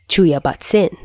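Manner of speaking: ordinary delivery, nothing marked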